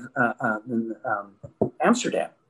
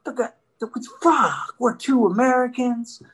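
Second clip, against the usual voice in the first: higher-pitched mock dismay; retold panic; 8 semitones higher than usual. Driver seeing police behind him, mildly acted panic. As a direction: act out an oh-no moment on being pulled over. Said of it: "all of a sudden I'm like".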